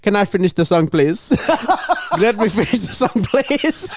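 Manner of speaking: lightly voiced indignant singer, high; scammer voice. Mimicking the scammer begging to finish singing, mildly high. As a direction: plead to finish the song. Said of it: "cuts her off and goes".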